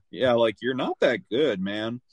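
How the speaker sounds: ordinary delivery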